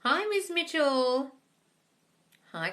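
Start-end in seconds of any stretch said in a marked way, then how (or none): none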